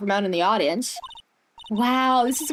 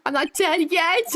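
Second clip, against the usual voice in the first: ordinary delivery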